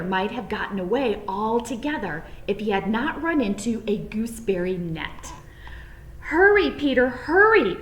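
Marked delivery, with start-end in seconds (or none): 6.2-7.8 high, loud urgent chirping; urgent sparrows. A narrator's reading. Sparrows urging Peter to flee, high loud urgency. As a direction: urge him on like little birds. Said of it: "urged some friendly sparrows".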